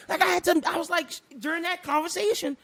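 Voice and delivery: ordinary talk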